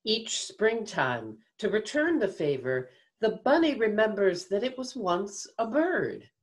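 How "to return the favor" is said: ordinary delivery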